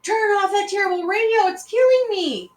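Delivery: high, loud pained shout; husband shouts at wife to kill the radio. A narrator's reading. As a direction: yell it as if the noise is killing you. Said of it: "Immediately he shouted".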